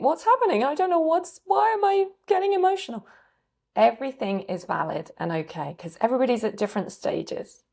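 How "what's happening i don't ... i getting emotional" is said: much higher, louder, bewildered and close to tears; tearful confusion. Retold or imitated. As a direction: voice a startled person welling up. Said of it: "might be tearful and think".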